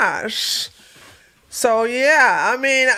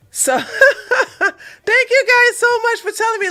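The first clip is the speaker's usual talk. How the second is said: laughing; 13 semitones higher, 5 dB louder than usual. Real, not acted. Laughs while thanking viewers.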